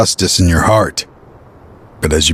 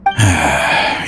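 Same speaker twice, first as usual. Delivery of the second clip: long tired exhale before admitting; weary sigh; 4 semitones lower than usual. Acted. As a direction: let the breath drop out heavily. Confession under pressure.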